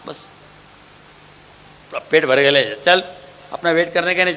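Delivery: slurring husband talking down to his wife; drunk husband. Acted. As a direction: brush her off, full and tipsy. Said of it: "आपने कहा".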